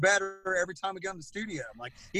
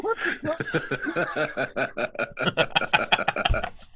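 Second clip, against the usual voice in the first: shocked; 3 dB louder, much faster than usual. Retold or imitated. Retold shock at friend's remark.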